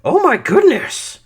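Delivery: sarcastic gasp, voice climbing way up; mock shock. Speaker mocking the trivial outrage, sarcastic. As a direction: ham up the disbelief. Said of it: "it's like".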